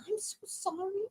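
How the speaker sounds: high, hushed sheepish apology; small apology. Retold or imitated. Comic retelling her apology to a stranger, small and sheepish. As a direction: squeak it out softly and timidly.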